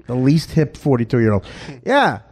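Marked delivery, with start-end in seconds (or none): 1.8-2.2 pitched-up mimicry of a radio host; Russo impression. Comic caps his bit on the unhip host, high-pitched impression. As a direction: toss out the host's catchphrase in his higher voice. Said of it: "Mandel Russo said".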